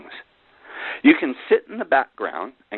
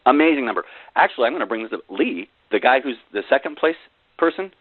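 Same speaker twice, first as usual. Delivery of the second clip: normal talking voice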